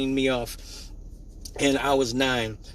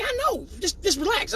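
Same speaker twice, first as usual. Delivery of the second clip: put-on coaxing girl voice.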